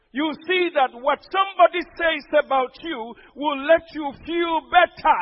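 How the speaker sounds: loud, rising sermon delivery, hammering each point; full-force preaching. Acted. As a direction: preach it out to the whole church.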